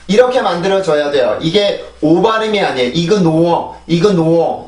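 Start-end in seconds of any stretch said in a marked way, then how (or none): 2.9-4.7 이그노어 read syllable by syllable as Korean, no r; Koreanised "ignore" as 이그노어; wrong pronunciation demo. Shown as a demonstration. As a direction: say 이그노어 with plain Korean vowels. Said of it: "이 발음이 아니고".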